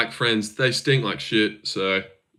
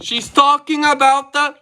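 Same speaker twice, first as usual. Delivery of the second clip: high mock Russian accent; goofy high Russian accent; 13 semitones higher, 3 dB louder than usual. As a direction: parody her Russian accent, pitched up. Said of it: "in that goofy little accent".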